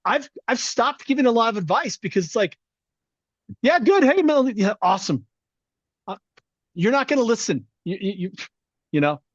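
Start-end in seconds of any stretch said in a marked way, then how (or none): 3.6-5.2 mock dismissive. Mock dismissive advice reply.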